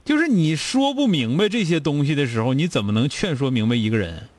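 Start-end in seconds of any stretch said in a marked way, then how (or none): none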